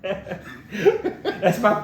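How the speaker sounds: laughing. Real, not acted. Laughing while talking.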